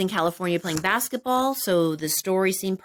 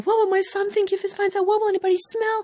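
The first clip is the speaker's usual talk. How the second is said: high, anxious inner voice, pace quickening at the end; paranoid worry; 12 semitones higher, faster than usual. Retold or imitated. Recalling her paranoia before smoking, high anxious voice. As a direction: fret in a high voice about getting caught.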